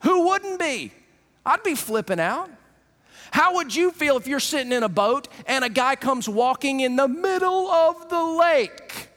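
usual speaking voice

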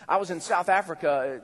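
ordinary talk, nothing marked

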